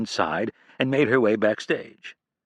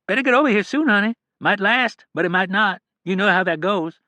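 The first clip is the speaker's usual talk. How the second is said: much higher, kindly caregiver voice; caring nurse; 11 semitones higher, 4 dB louder than usual. A narrator's reading. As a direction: gently warn the daughter not to hope too much. Nurse Janine voiced by narrator.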